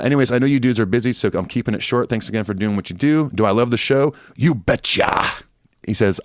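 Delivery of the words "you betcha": James. Retold or imitated. Reading a listener's email sign-off, lightly imitated rock frontman. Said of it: "A la James in Bells from [name]".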